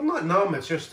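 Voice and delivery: ordinary talk, nothing marked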